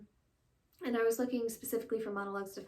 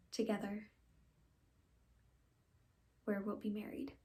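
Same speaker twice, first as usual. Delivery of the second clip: subdued stage confession, growing softer; dramatic monologue; 3 dB quieter than usual. Acted. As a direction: break the news quietly, with long pauses. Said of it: "I'll be doing a monologue".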